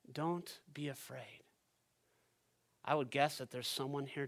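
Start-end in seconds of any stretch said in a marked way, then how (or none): 0.1-1.4 comforting. Shown as a demonstration. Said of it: "words of comfort".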